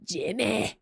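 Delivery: one-word burst of put-on Scottish accent. Shown as a demonstration. Streamer repeating their best attempt for chat, Scottish accent. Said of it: "Scottish accent I can do".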